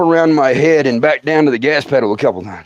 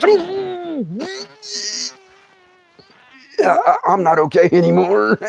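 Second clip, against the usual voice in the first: pained groan. Retold or imitated. Comic retelling of pain.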